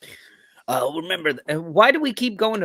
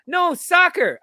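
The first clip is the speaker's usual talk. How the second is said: shout; 11 semitones higher than usual. Retold or imitated. Acting out an argument.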